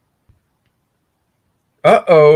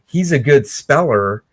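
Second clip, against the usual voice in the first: ordinary talk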